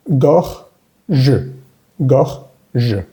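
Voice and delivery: "Gorge" split as "gor ... je", final schwa sounded; split syllables. Shown as a demonstration. Teacher builds the word, "Gorge" in two parts with schwa. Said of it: "esa E sin acento al final".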